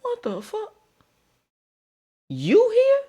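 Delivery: high-pitched shock at seeing him. Retold or imitated. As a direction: squeal out the surprise. Shocked run-in at graduation.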